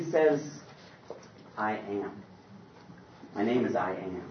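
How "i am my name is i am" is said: very soft, low and still; hushed God voice. Acted. God answering Moses in a soft hush. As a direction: let God's name fall almost silent.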